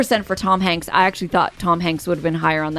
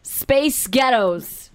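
slow, higher American delivery; deliberate American accent test; 4 semitones higher, much slower than usual. Shown as a demonstration. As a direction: draw out Space Ghettos in American. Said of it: "now you two say Space Ghettos".

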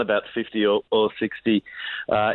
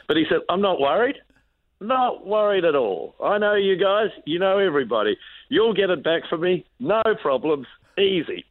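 breezy, much higher happy-go-lucky voice; 7 semitones higher than usual. Retold or imitated. Bon telling the roadies his bike's gone again, breezy and high. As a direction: toss it off like nothing could worry him.

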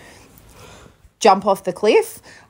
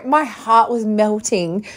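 ordinary talk, nothing marked

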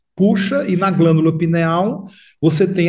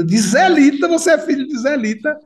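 surprised; 8 semitones higher than usual. Retold or imitated. Delegate hears his mother's name.

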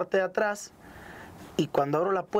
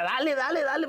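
high, repeated push to go; retold urging; 8 semitones higher than usual. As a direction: hurry the driver along. Urging the driver to go.

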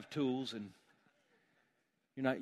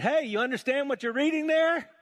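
loud, high call while running alongside the chariot; Philip calling out; 13 semitones higher, 10 dB louder than usual. Retold or imitated. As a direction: shout up to the chariot rider.

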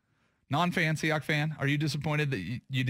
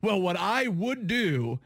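slow, higher-pitched mock politician; evasive politician; 5 semitones higher, slower than usual. Host mimicking a candidate dodging the question, slow politician voice. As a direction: stall with a drawn-out politician's preamble. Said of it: "every politician does it".

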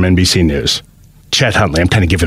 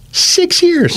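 amazed. Real, not acted. Amazed at the short career.

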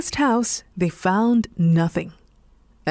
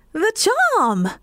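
much higher alarmed cry; cat's dismayed cry; 12 semitones higher than usual. A narrator's reading. Cat losing the charm in the river, dismayed cry. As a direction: gasp it out in horror. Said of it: "the cat cried".

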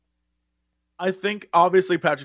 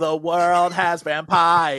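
very slow, dragged-out and droning; much slower than usual. Retold or imitated. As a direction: stretch every word like a gloomy rock singer. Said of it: "him to roll in and be like".

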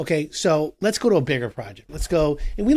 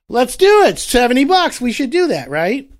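much higher and louder, carried away; giddy homeowner; 9 semitones higher, 9 dB louder than usual. Retold or imitated. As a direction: sell yourself on the splurge. Homeowner giddy over cheap tile.

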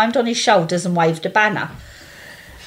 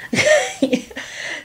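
high squealing laugh. Real, not acted. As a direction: shriek with laughter. Laughing at friend.

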